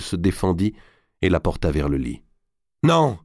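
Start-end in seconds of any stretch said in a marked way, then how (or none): 2.8-3.2 high, defiant cry; cried out. A narrator's reading. Marguerite refusing to sign as she struggles, crying out. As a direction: cry out the refusal, pitched right up. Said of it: "cria-t-elle".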